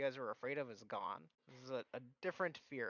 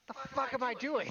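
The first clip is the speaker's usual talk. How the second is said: baffled outburst; 12 semitones higher, 6 dB louder than usual. Acted. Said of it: "and then you're like".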